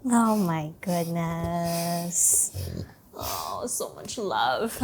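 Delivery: soft, slow, tender cooing. Real, not acted. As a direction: melt over the dog, softly. Tender softer cooing at dog.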